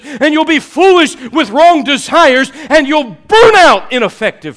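loud, high preacher's cry; preaching at full force. Acted. Preacher warning the congregation, in a loud high cry. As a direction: drive the warning home from the pulpit.

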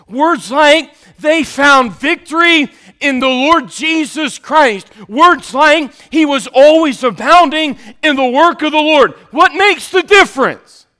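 loud, high, ringing preacher's call; full-force preaching. Acted. Preacher crying out to the congregation, loud and ringing.

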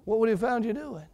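usual speaking voice

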